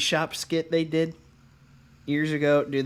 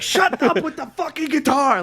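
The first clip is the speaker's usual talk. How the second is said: higher, louder sudden outburst; mock snap; 10 semitones higher, 4 dB louder than usual. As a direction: bark it at the guitar player. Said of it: "Just randomly snaps".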